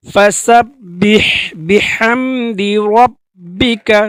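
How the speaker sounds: ফাসাব্বিহ বিহামদি রব্বিকা slow, each syllable drawn out; Quran recitation. Shown as a demonstration. Teacher models the verse slowly for learners. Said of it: "তিনটা শব্দ আমরা যদি একসাথে পড়ি".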